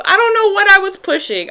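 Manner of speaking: high, loud burst of exasperation; sudden high-pitched cry; exasperated outburst. Real, not acted. Sudden exasperated outburst about recording.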